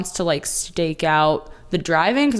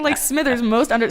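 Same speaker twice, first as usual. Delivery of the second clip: normal talking voice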